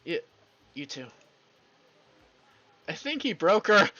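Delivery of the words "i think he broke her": loud amused aside. Real, not acted. Friend teasing the group about frozen Reva, amused and loud. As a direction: tease the table, louder and higher. Players laugh at stunned Reva.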